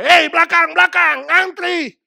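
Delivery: loud, high yell to the queue jumper; shout. Retold or imitated. Angry shopper yelling at queue jumper, loud high shout. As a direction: bellow at him to get back in line. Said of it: "teriak dia".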